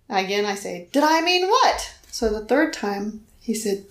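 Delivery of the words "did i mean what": high, loud indignant mock yell; yelled back. Wife retelling yelling at God a second time, high and loud. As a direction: fling the question back. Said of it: "I yelled back".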